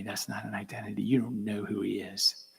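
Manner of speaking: hushed inner thought; whispered reflection. Real, not acted. Speaker reflecting on enemy, whispering. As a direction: whisper it to yourself. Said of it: "inner reflection".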